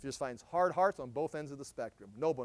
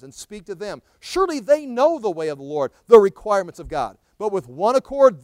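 fiery preaching; 3 semitones higher, 14 dB louder than usual. Acted. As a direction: thunder the prophet's lament at them. Preaching scripture.

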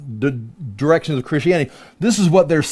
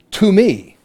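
"to me" pitched up and set apart; emphatic; 5 semitones higher than usual. Acted. Satan claiming his authority, emphatic. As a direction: claim it with a raised "to me". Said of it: "Emphatic in the second part".

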